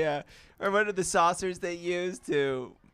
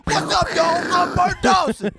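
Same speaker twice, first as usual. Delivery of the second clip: high, loud showman intro; cocky daredevil; 7 dB louder than usual. Pretend. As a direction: introduce yourself like a hype star. Performing Bart introducing himself.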